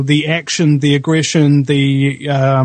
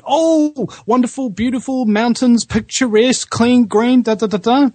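high, gushing voice of someone charmed; gushing admirer; 8 semitones higher than usual. Retold or imitated. Host imitating people gushing about New Zealand. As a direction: coo it like a dazzled fan of the country. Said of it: "what comes to mind".

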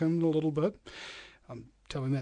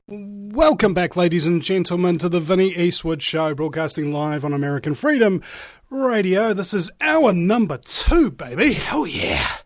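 radio announcer; 3 semitones higher, 6 dB louder than usual. Acted. Radio host opening the hour.

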